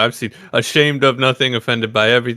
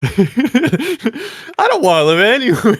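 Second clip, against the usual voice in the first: laughing; 11 semitones higher, 3 dB louder than usual. Real, not acted. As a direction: laugh out the refusal. Laughing at friend's suggestion.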